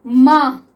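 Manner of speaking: माँ said loud with a long nasal aa; teacher reading out माँ; model pronunciation. Shown as a demonstration. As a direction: model माँ clearly and loudly for students. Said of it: "next देखिए".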